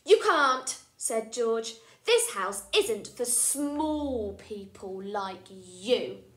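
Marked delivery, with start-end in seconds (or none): 0.0-0.8 put-on loud, bossy little boy's no; bossy refusal. George blocking Freddy at the door, loud and bossy. 2.0-6.2 slow, loud, bossy decree; bossy rule. Acted. George telling Freddy he is too small, slow and bossy.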